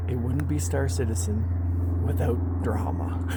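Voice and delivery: slow wry whisper. Real, not acted. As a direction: breathe it out as a sly aside.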